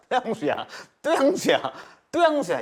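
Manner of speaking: high-pitched, laughing repeats; amused repetition. Real, not acted. Teacher showing students it stays positive, amused and high. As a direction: let the pitch leap as it repeats.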